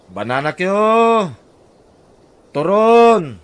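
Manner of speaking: loud, high street vendor's call. Acted. Vendor hawking banana cue and turon to passersby, loud high calling. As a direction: hawk your banana snacks to the whole street.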